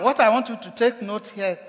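ordinary talk

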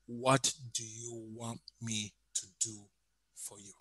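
quiet, measured voice of God answering; gentle divine question. Acted. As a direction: ask it hushed, word by word. God's question voiced softly, slowly.

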